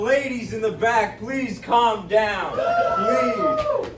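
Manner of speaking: slow, drawn-out plea to settle down, then a sudden high cry; calling out to the crowd. Real, not acted. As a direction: drag out please calm down, then yelp please.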